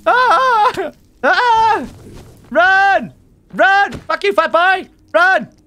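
loud high panicked yells; panicked screaming. Real, not acted. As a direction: scream and flee for your life. Fleeing mobs in panic.

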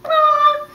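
very high, squealed "ah"; imitated flailing turtle. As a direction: repeat the turtle noise, high. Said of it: "One more time".

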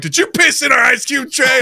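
high, loud mock outrage; outraged accusation. Prank victims confronting the culprit, mock outrage. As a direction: imitate disgusted victims yelling a question.

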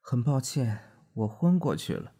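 groggy man apologizing, voice lifted; a lightly voiced drunk husband coming round; dazed husband. A narrator's reading. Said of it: "他说".

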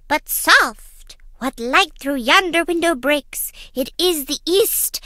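high declaiming Shakespeare voice; hammed-up balcony speech; theatrical Romeo. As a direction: proclaim it like a stage Romeo.

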